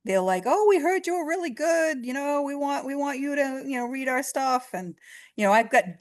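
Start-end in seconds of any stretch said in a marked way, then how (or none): 0.5-4.7 excited high voice. Retold or imitated. Excited clients quoted.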